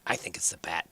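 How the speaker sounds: secretive whisper. Acted. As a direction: lean in and whisper it. Hori confides about the bat.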